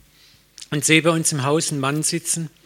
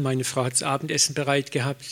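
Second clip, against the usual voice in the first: normal talking voice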